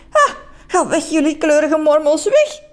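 high, cross exclamation; irritated shooing. Acted. Man shooing away colourful salamanders, cross and high. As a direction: chase them off, rising to a high cry.

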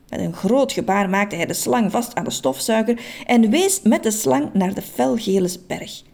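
ordinary delivery, nothing marked

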